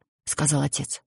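plain reading voice

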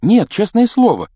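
eager, loud reassurance; heated protest. Acted. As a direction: insist hotly that it's true. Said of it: "горячо возразила Гермиона".

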